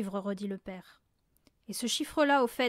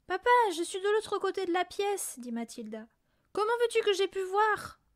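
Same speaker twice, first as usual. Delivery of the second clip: put-on girl's calm high voice; 8 semitones higher than usual. A narrator's reading. Mathilda reasons with father.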